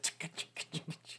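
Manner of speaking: soft voiceless rapid zaps; imitated electric crackle. Retelling the electrocution, imitated crackling. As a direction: tick out little sparks with the tongue.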